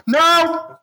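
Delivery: a silly high-pitched "Now"; high squeaky now. Shown as a demonstration. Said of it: "you gotta go".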